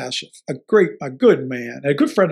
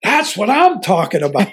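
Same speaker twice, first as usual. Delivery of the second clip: higher, louder cheer of delight; retold excited scream; 5 semitones higher, 4 dB louder than usual. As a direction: whoop it like a thrilled coach on the sideline. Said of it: "And I'm screaming".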